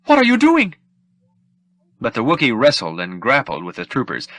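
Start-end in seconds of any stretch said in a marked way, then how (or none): 0.1-0.8 very high, loud fussy robot voice in panic; frightened droid shriek. Acted. The droid shrieks at Chewbacca fighting troopers, very high panic. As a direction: squeal it like a terrified droid. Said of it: "three P O's frightened shrieks".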